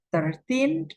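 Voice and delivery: put-on thirteen pitched high with a rising teen; stress on TEEN. Shown as a demonstration. Teacher contrasts with thirty, stress on teen. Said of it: "penekannya di tin".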